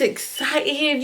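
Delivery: high, drawn-out excitement; acted-out excited aunt. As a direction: stretch it out with mimicked delight. Acting out aunt's delighted reaction.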